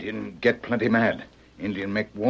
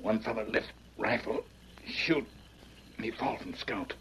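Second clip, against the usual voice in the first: weak and hurt; 7 dB quieter than usual. Acted. Wounded man tells of shooting.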